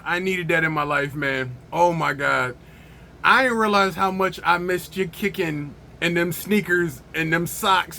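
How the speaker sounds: usual speaking voice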